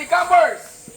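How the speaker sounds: high, loud call to a crowd; rallying shout. Real, not acted. Leader asking campers if they are ready, called out. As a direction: fire up the crowd.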